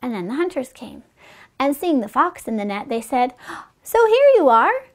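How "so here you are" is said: very high, loud storybook cry; hunters' exclamation. A narrator's reading. Hunters finding the fox in the net, loud high exclamation.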